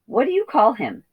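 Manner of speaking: a hint of another voice; lightly voiced character. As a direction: ask it in a character voice.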